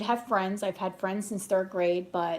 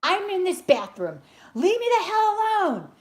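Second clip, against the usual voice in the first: much higher and louder, angry retort building up; retold angry outburst; 12 semitones higher, 3 dB louder than usual. Yelling back at the lady through the bathroom door, angry. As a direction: tell her off, reliving the fury. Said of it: "I was flipping out".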